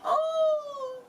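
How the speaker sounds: very high, shrill cry of horror; a squeal of fright; horrified gasp. Acted. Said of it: "eut une exclamation d'horreur". (exclamation d'horreur de tante Pétunia)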